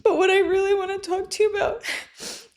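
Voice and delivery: high, wobbling voice between crying and laughing; tearful laugh-cry. Retold or imitated. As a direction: cry and giggle at once. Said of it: "because I was in tears".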